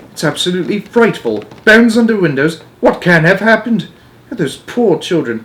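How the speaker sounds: high, loud, appalled; a queen shaken by the news; horrified Queen. Acted. Queen reacts to the missing children, high appalled voice.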